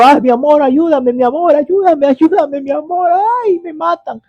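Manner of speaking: high-pitched panicked pleading without real volume; hammed-up, high nightmare yelp, held back in loudness; mock scream for help. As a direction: cry out for help as if trapped in a nightmare. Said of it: "tú estás gritando".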